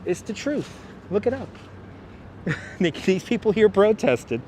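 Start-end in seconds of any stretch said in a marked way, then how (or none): none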